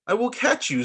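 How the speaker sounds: calling out. Acted. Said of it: "called little Z".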